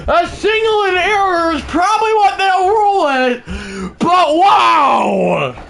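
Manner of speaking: very high, loud, cracking shouted call; screaming home-run-style call. Pretend. Announcing the Tigers' first run to viewers, frantic shouting. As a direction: scream the play like the ballpark is going wild.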